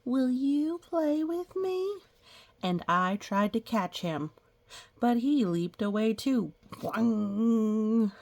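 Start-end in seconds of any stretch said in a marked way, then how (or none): 0.1-2.0 high, slow, sweet asking; childlike asking. A narrator's reading. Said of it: "I said". 6.7-8.1 bouncy boing effect; playful cartoon boing. Sound effect for leaping frog.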